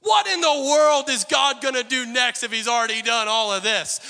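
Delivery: loud, high rhetorical outburst; excited shout. Acted. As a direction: shout it out in wonder. Preacher shouting rhetorical question.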